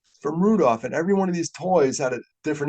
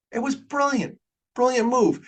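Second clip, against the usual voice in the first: much higher, bursting with enthusiasm; fired up; 11 semitones higher than usual. Real, not acted. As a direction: gush with delight. Fired-up praise of Rankin.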